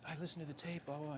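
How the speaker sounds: high-pitched imitated caller. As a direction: lift the pitch and rattle it off as mimicry. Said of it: "he goes".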